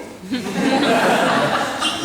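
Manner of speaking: real laugh bursting out; big genuine laugh at own joke; loud laugh. Real, not acted.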